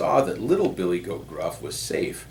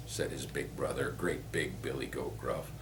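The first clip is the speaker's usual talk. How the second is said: plain reading voice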